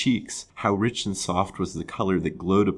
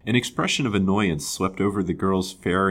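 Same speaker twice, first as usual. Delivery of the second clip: ordinary delivery, nothing marked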